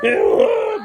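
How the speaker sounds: dad yelps at his son mid-chat; sudden shout. Real, not acted. Sudden burst at kid.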